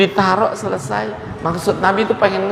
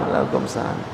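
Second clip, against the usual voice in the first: whispered greeting; 8 dB quieter than usual. Acted. Replying to the prayer's closing greeting.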